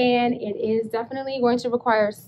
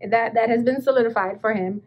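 ordinary talk

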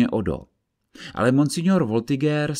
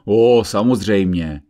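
angry sarcasm; 8 dB louder, slower than usual. Acted. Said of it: "odpověděl Odo stále rozlobený".

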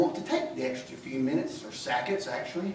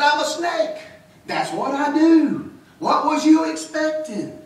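the snake, raised and smug, a bit louder; lightly voiced smug snake, pitched up; sly snake; 11 semitones higher, 7 dB louder, slower than usual. Snake answers boy in parable.

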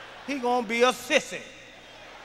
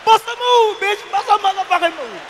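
much higher, louder mock yell; hammed-up shout; 9 semitones higher, 7 dB louder than usual. Comic squaring up to his wife, mock shouting, higher and louder. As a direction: yell the challenge like a street fight.

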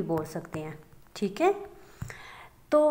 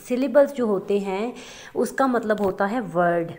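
normal talking voice

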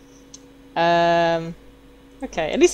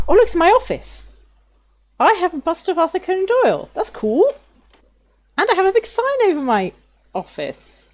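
high, bright-eyed excitement; delighted; 8 semitones higher than usual. Real, not acted. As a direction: gush over the discovery. Delighted at own office.

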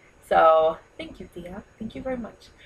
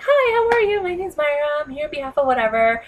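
bright door-to-door salesperson greeting, much higher; chirpy pitch to a shop owner; sales pitch; 7 semitones higher, 6 dB louder than usual. Retold or imitated. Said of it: "I'm like".

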